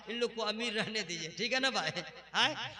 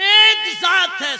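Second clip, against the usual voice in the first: slow, loud, higher burst; shouted declaration; 9 semitones higher, 17 dB louder, much slower than usual. Acted. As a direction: announce it to the hall. (ज़ोर से ऐलान)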